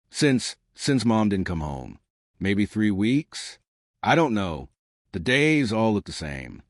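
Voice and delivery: ordinary delivery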